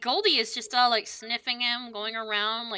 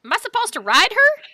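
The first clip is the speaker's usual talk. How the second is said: eager loud question; 7 semitones higher, 7 dB louder than usual. Acted. Sunny asks eagerly.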